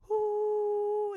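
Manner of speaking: very high ooh; high falsetto. Shown as a demonstration. Showing the high voice first. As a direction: slide up into head voice. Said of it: "You go into this high voice".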